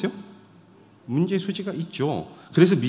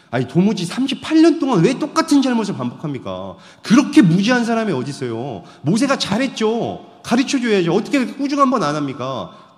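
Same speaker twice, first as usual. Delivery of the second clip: indignant protest; 8 semitones higher, 7 dB louder than usual. Pretend. Voicing an indignant objection.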